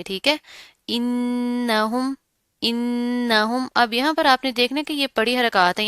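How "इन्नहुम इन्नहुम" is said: "इन्नहुम" with a long nasal hum on "न्न"; slow chanted "इन्नहुम", humming the n; ghunna. Shown as a demonstration. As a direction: hold the doubled n of "इन्नहुम", then move on. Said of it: "गुन्ना करके आपने जल्दी से गुजर जाना है".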